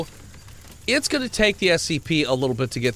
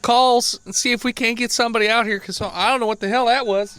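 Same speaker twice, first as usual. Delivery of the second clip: flustered sheriff; 9 semitones higher, 4 dB louder than usual. Acted. Sheriff shaken after monster.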